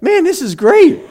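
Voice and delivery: high, loud, laughing excitement; delighted realization. Retold or imitated. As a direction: burst out thrilled, pitch leaping up. Retells his delighted realization.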